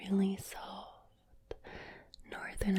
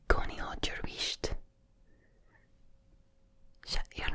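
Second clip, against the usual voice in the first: whispered broad Glaswegian with rolled R's; proper Glaswegian; 3 semitones higher, 3 dB louder than usual. Shown as a demonstration. Glaswegian telling someone to hush and sleep, whispered Glaswegian accent. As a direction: whisper slangy Glaswegian, rough and ready. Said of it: "proper Glaswegian".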